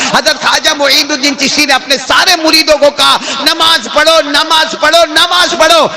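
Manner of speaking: high, sustained sermon delivery; full-force preaching. Acted. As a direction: declaim it high to a congregation. (بلند سُر میں پرجوش خطابت)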